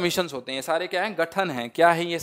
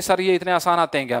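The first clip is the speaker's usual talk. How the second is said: usual speaking voice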